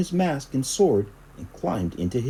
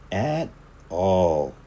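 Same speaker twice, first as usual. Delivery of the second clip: ordinary delivery, nothing marked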